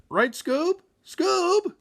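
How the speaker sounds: worried call. Acted. Shaggy calling silent Scooby.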